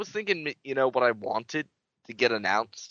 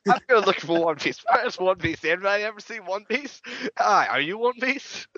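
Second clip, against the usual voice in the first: silly searching; 7 semitones higher than usual. Pretend. Comic search for the treasure.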